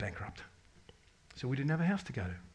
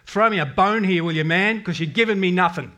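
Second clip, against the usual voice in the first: louder, higher, fed-up demand to God; cranky Jude; 6 semitones higher, 11 dB louder than usual. Retold or imitated. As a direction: snap at God like a frustrated friend. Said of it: "it was cranky Jude".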